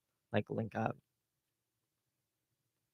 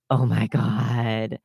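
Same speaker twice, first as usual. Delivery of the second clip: slow, drawn-out mock horror; hammed-up scandalized reaction; mock shock; 3 semitones lower, much slower than usual. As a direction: stretch it out in feigned disbelief. Mock shock after gasp.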